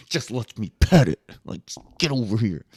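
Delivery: loose, lightly imitated comic voice; lightly voiced Theo Von. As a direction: mimic a laid-back comic. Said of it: "He's like".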